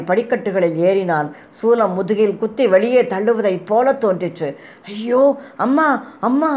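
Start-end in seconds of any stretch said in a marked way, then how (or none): 4.8-6.5 rising terrified wail, much higher; cry of terror. A narrator's reading. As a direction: cry out for mother in panic. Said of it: "என்று அலறினான்". (பயத்தில் உயர்ந்து அலறல்)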